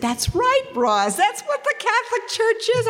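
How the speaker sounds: very high, excited outburst; excited, high-pitched retort; imitating excited brother. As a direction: mimic an overjoyed brother, pitch shooting up. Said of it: "he got all excited".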